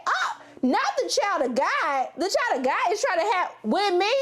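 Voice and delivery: hammed-up horror at the church boy; mock scandalized. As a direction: drag it out, shocked and appalled. Her shocked reply to church boy.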